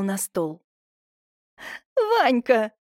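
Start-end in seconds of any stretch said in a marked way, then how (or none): 2.0-2.7 high, loud playful cry; laughing exclamation. A narrator's reading. Semyonovna cries out to her lover, high and mildly amused. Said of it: "засмеялась Семёновна".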